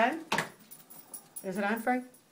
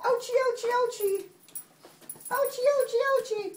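high little singsong whimper-words; mock pained squirrel; 8 semitones higher than usual. Squirrel with its tail in River's mouth, mock pained voice. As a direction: play a toy being bitten, high and falling. Voicing toy squirrel's pain.

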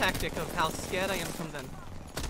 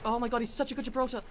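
ordinary delivery

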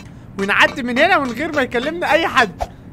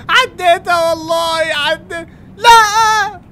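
high, loud relieved cry; relieved near-crying cry; 7 semitones higher, 9 dB louder, much slower than usual. Real, not acted. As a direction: break out in relief. Surviving the lasers.

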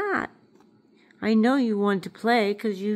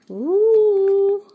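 high, loud delighted ooh; 10 semitones higher, 9 dB louder than usual. Real, not acted. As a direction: coo with sudden delight at the pet. Delighted at pet's eggs.

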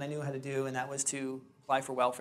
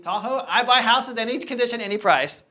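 loud, high sales cry; hawking announcement; 8 semitones higher, 10 dB louder than usual. Acted. New investor advertising to all of Tahoe, loud hawking announcement. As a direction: call it out big to the whole town. Re-enacting his sales call to the town.

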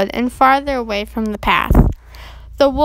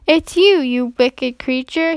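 ordinary delivery, nothing marked

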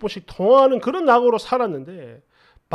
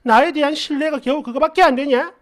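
imitated upset girlfriend, high-pitched; hammed-up high, aggrieved complaint; tearful outburst; 4 semitones higher than usual. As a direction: plead and protest like a wailing girlfriend. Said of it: "울고불고".

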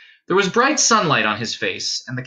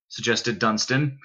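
ordinary delivery, nothing marked

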